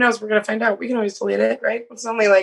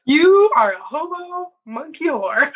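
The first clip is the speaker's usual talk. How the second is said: mocking his message; 4 semitones higher than usual. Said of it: "reading his crude message".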